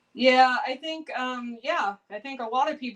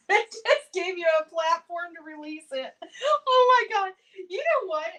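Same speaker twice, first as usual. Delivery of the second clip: high-pitched, laughing through the words; 7 semitones higher, 5 dB louder than usual. Real, not acted. Streamer cracks up replying to Hedgehog, laughing. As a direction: talk through a real fit of laughter.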